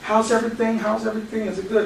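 a hint of a chirpy server's voice; waitress. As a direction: softly check in on diners like a waitress. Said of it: "the waitress will come".

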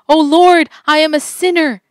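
loud, high cry; yelled out. A narrator's reading. Woman yelling out to Jesus, loud and high. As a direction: cry out to the Lord in shame. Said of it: "she yelled out".

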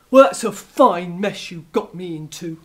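loud, low, sore wolf; a beaten wolf complaining; grumbling wolf. Acted. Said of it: "he said".

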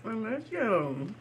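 tender. Real, not acted. Talking to the food.

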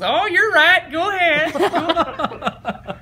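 very high, loud coach impression; hammed-up high yell, drawn out at the end; Spurrier. Coach suddenly giving in to player, high loud hammed-up imitation. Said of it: "He goes".

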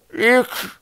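high, muffled single word; swollen-mouth voice. A narrator's reading. Swollen boy steers Twig, muffled swollen-mouth voice. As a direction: squeeze it out through a swollen mouth. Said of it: "war Knorpel so angeschwollen, dass sogar die einfachsten Worte unmöglich waren".

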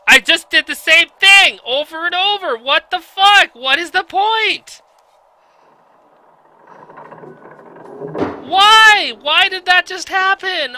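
high, loud disbelieving rant; exasperated outburst. Real, not acted. Gamer yelling at the pointless level, high exasperated outrage. Frustrated at game repeating.

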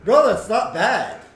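loud, high laughing bro; laughing loudly. Real, not acted. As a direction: burst out laughing at it.

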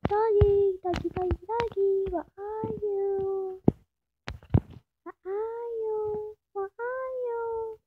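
high, slow sing-song calling; calling the dog. Real, not acted. Player calls her lost dog, high and slow.

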